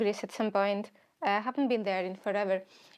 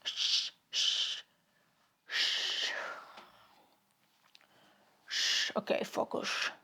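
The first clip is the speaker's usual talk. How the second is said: lisping Batman; 4 dB quieter, slower than usual. Retold or imitated.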